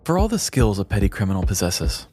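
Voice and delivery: usual speaking voice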